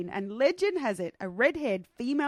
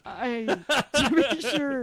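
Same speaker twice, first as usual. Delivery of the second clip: mock Irish accent; slower than usual. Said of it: "required to do an Irish accent".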